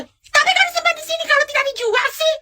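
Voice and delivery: put-on high-pitched whining kid crying for a toy; whiny child. Spoiled kid whining to mom at the store, crying. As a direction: wail like a spoiled child who wants it.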